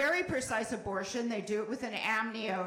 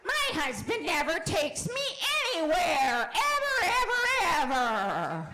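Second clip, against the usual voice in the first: mock whiny wife; 6 semitones higher, 3 dB louder than usual. Mock whiny complaint bit.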